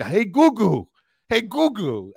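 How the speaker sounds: high, far-off mimicry of a grandma mispronouncing "Google" as "Googoo"; old Italian grandma. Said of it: "she always said".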